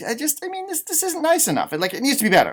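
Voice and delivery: ordinary delivery, nothing marked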